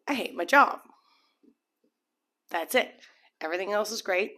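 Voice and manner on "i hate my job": louder and higher, fed up with "I hate my job."; exasperated outburst. Retold or imitated. Reader voicing a fed-up client, louder exasperated outburst. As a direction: blurt "I hate my job." loud and high, like you've had enough.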